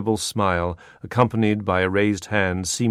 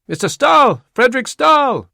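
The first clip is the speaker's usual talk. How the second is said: high, loud call across the pier; shouting over a crowd; 9 dB louder than usual. Acted. Greeter shouting to Stahl over the band. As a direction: yell the name to be heard over a brass band. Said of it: "a shout rose above the cornets".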